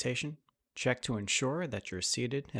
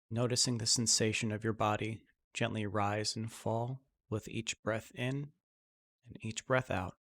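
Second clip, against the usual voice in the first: guided meditation; 3 dB quieter than usual. Acted. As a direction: ease them into calm breathing, unhurried. Leads a guided breathing meditation.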